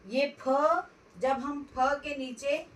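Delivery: usual speaking voice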